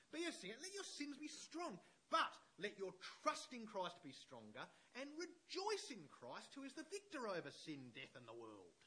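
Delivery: high, loud preacher's declamation; impassioned quote reading. Acted. Preacher reading Luther's letter to church, impassioned declamation. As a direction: declaim it with rising fervour, leaning on key words.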